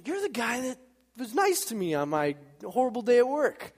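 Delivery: high, eager mock exclamation; excited recognition. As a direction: imitate delighted surprise at spotting someone. Newcomer recognizes Jim.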